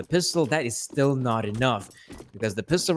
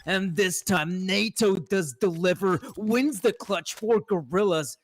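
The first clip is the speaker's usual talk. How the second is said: excited; 6 semitones higher than usual. Real, not acted. Excited call of the clutch.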